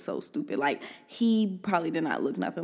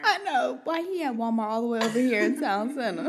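high-pitched, laughing disbelief; real giggly, incredulous question; 5 semitones higher, 4 dB louder than usual. Real, not acted. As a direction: crack up while asking. Joking about the look-alike at Walmart.

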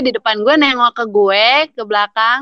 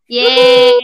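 happy yay; 8 dB louder than usual. Real, not acted. Cheering at podcast outro.

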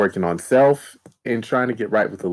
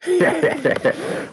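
evil chuckle; high, dark villain laugh; sinister laugh. Real, not acted. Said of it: "Why'd you have to do that sinister laugh".

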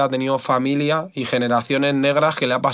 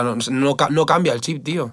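normal talking voice

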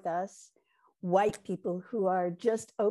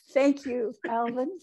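ordinary talk

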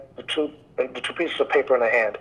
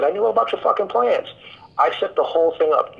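mock outburst, pitched up high; higher-pitched, mildly heated retort; retold angry outburst; 6 semitones higher than usual. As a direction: act out losing it, without raising the volume. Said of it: "David lost it".

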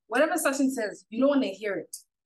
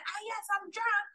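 high drunk imitation; 10 semitones higher, 6 dB quieter than usual. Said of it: "she kept being like".

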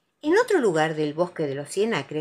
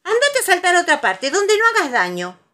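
high, loud cross reprimand; scolding; 12 semitones higher, 8 dB louder than usual. A narrator's reading. Conejo to clumsy Tiger, scolding. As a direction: tell him off sharply. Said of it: "lo regañó Conejo".

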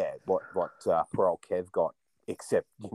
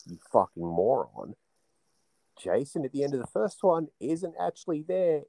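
normal talking voice

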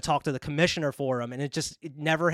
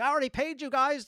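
mildly indignant, higher voice; retold annoyance, pitched up; pissed off; 9 semitones higher than usual. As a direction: act out a mild protest. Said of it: "That dude comes in pissed off".

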